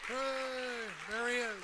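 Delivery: high, bright call across the room. Real, not acted. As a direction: hail someone across the hall. Emcee spots sponsor in crowd.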